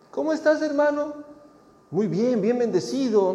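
two churchgoers exchanging a cheerful hollow greeting; cheery church greeting. Retold or imitated. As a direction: sing it out all sunny and fake.

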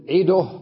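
عده as 'iduh, loudly; pause with vowel transfer. Shown as a demonstration. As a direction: put u on the د of عده. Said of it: "أقول".

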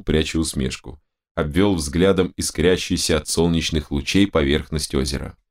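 usual speaking voice

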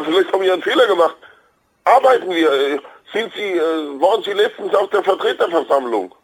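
usual speaking voice

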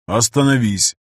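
echoing inhuman voice; cold alien command echoing in the head. Acted. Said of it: "нечеловеческий голос".

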